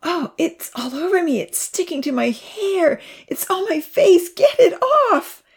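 high panic building to a loud squeal; panicked girl. A narrator's reading. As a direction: start high, climb into frantic shrieking. Girl panics in sticky web.

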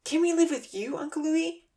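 put-on high childlike plea; pleading. A narrator's reading. Santa pleading with Uncle Louie, high and hopeful. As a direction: ask like a hopeful little girl. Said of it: "Santa pleaded".

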